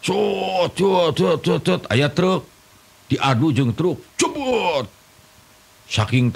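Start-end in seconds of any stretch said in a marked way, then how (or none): 0.1-1.8 high mock engine whine; motorbike noise. Mimicking the motorbike racing off, high engine noise. 4.1-4.9 high sudden mock crash sound; crash noise. Telling of the motorbike hitting the truck, high crash noise.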